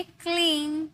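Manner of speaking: क्लीन slowly, ee drawn out; pronunciation model. Shown as a demonstration. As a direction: stretch the ee in क्लीन for learners. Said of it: "इसे हम कहेंगे". (क्लीन में खिंची हुई ई)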